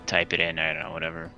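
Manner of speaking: ordinary talk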